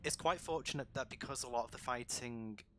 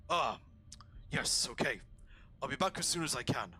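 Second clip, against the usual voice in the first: orc voice; 4 semitones higher, 4 dB louder, faster than usual. Acted. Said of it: "the other one just goes".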